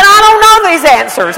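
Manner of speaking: high, loud frazzled cry; flustered panic. Retold or imitated. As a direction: throw up your hands in exasperation. Flustered retort to husband.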